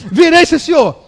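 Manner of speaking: high, very loud yell; frantic mock compliance. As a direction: imitate a man shouting he gives in. Slapped man shouting his conversion.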